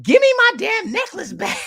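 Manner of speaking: high, loud demanding yell; Nunu impression. Imitating Nunu of ATL wanting necklace, high yell. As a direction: shriek it as an outraged demand. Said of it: "She's like".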